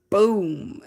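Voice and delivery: punchy boom. Retold or imitated. Energy charge shooting up.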